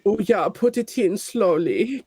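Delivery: breathy seductive mock German; sultry German accent. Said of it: "there's the kind of German".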